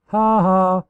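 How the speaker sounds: loud, high gleeful laugh; a mischievous, hammed-up victory laugh; fiendish giggle. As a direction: cackle in triumph. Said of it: "Luna giggled again fiendishly".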